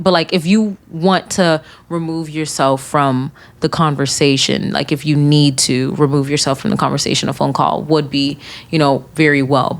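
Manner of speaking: usual speaking voice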